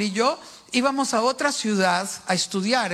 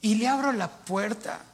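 normal talking voice

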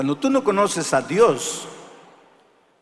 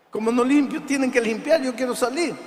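retold exasperation, higher; exasperado; 9 semitones higher than usual. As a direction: push back, incredulous and insistent. Insisting to the cleaning woman. (exasperado, más agudo)

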